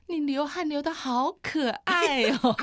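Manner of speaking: slow, high, doting coo; sweet tender compliment. Retold or imitated. Wife cooing to sweaty husband, slow high tender. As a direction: coo it lovingly with a laugh. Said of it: "我突然间说出一句话".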